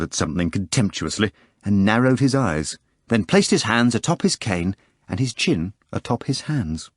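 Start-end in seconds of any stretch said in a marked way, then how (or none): none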